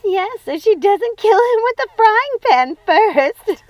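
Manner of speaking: talking through laughter; laughing. Acted. Sister joking about the frying pan, laughing high.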